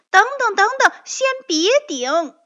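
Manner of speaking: urgent shout to stop. Acted. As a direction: yell to stop him in a panic. Rabbit shouts to stop friend. (惊慌地大喊)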